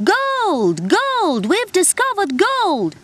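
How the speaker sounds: high, loud gnome call stretched out; shouting at the top of his voice. Acted. Gnome calls to the humans from the mountaintop, loud and high. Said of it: "shouted at the top of his voice".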